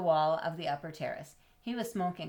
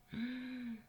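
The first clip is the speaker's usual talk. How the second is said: soft gasp; 6 semitones higher, 9 dB quieter than usual. Acted. Reader gasps at the cat.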